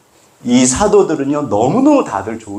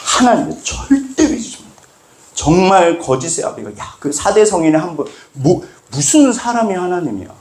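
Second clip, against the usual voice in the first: mocking scoffer; 6 semitones higher than usual. Voicing scoffers who deny Jesus.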